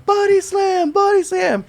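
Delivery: high, strained mock chant; crowd egging on. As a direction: imitate a crowd cheering him on. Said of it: "everybody's telling him".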